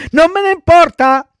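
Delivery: high, loud bratty retort; defiant child puppet. Acted. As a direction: throw it back like a stubborn kid. Pinocchio defiantly rejects the warnings. (bambino sfrontato, voce acuta)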